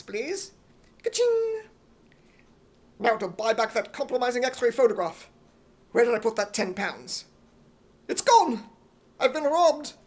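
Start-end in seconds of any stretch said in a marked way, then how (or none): 1.0-1.6 very high cartoon till ring; cash register. Till sound. 8.1-9.9 high, loud alarmed outburst; shocked cry. Acted. Discovers money stolen.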